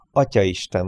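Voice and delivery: ordinary delivery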